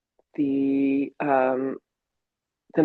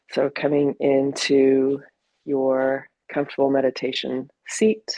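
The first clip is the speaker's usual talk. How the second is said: calm, unhurried meditation guidance with long pauses; guided meditation. Acted. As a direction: ease them gently into the body. Leads a meditation.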